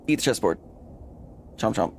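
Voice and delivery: usual speaking voice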